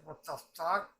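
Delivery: loud disbelieving "What the fuck?" rising at the end; voicing a stunned character; baffled exclaim. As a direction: blurt it out in shock. Repeating baffled reaction.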